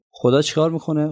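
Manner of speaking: ordinary talk, nothing marked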